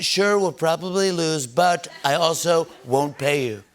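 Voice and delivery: slow, deliberate mock sales pitch; drawn-out impression, word by word; Donald Trump. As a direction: stretch it out slowly in a put-on voice. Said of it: "He's like".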